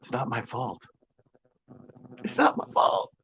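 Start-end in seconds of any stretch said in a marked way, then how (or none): 2.2-3.1 high, breathy sarcastic mock protest; mock whiny falsetto. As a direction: say it like a pouty fake excuse, way up high. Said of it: "The sarcasm just came out".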